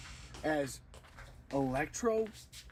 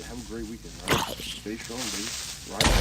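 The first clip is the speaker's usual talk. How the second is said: ordinary delivery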